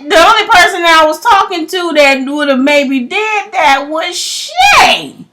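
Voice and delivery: loud, high, worked-up realization; building panic as it dawns; oh no, it was Shay; rising alarm. Real, not acted.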